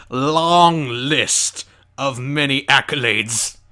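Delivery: slow, drawn-out pronouncement; मज़ाकिया भव्यता. Acted. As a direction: stretch every word out grandly. Mock-grand summing up of credits.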